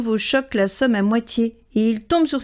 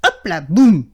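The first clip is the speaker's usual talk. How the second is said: mouse tumbling; 4 semitones lower, 6 dB louder than usual. A narrator's reading. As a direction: lower and louder on the crash, boum landing hard. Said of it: "fait Souriceau en tombant de l'arbre".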